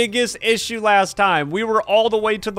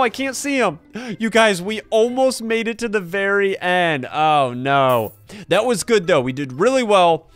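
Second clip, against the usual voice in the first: ordinary talk, nothing marked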